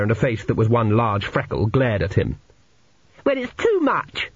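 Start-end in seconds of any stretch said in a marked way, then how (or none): 3.3-4.3 high, slow whinge of a fed-up schoolboy; indignant schoolboy. A narrator's reading. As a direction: complain in a put-on high boy's voice. Narrator voices indignant Cheesewright.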